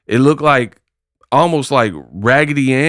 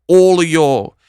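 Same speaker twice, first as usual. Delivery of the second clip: mock New York accent, pitched higher; Clarissa Shields's New York accent; 5 semitones higher than usual. Vlogger mimicking a boxer's sudden New York accent, mocking higher-pitched impression. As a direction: exaggerate a borrowed New York accent in mockery. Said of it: "how do you have this accent where she's like".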